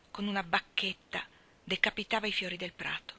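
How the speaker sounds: plain reading voice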